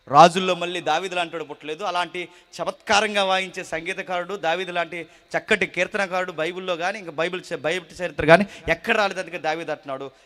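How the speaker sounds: normal talking voice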